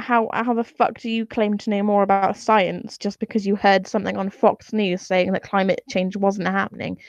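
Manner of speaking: normal talking voice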